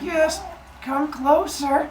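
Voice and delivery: high, slow, coaxing come closer; sly luring. Acted. Gaia luring husband Uranus in close, slow and sly. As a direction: draw him in, slow and sweet.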